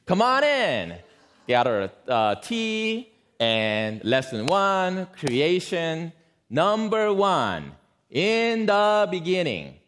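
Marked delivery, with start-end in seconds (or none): 0.0-1.0 high, bright greeting at the door with a laugh; high-pitched friendly neighbor, laughing; cheerful neighbor. Retold or imitated. Neighbor invites her in. 2.3-9.8 very slow, overly careful reading aloud; slow, deliberate reading to a non-English speaker; reading very slowly. Retold or imitated. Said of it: "because you read English slowly".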